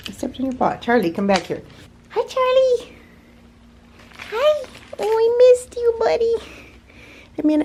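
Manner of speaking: high, sing-song pet voice. Real, not acted. Greeting and cooing at the cat.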